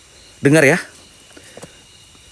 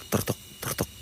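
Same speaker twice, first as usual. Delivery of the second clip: breathy whispered hoof sounds, tertok tertok; soft whispered clop clop; whispered clip-clop imitation; 16 dB quieter than usual. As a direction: mimic horse hooves on stone under your breath. Imitating horse cart on stones.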